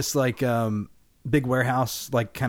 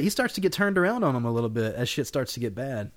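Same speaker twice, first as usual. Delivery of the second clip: ordinary talk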